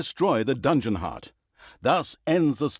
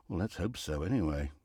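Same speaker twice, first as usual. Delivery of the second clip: doubtful aside; 9 semitones lower, 10 dB quieter than usual. Acted. Doubtful aside after praise.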